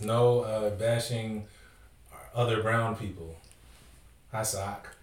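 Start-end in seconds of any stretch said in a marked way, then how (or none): none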